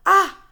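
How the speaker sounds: loud sharp gasp of realization; a startled loud ah; sudden gasp. A narrator's reading. Brian catching a sudden thought, a loud gasp.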